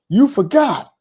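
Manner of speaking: high, loud accusing cry; screaming accusation. Retold or imitated. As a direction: yell it at him like he betrayed her. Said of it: "you know what she screams out".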